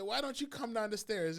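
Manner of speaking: loud, high, fed-up question; exasperated quote. Retold or imitated. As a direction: demand it, loud and incredulous.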